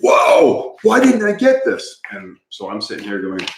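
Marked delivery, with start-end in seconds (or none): 0.0-2.0 surprised. Retold or imitated. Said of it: "I'll be like".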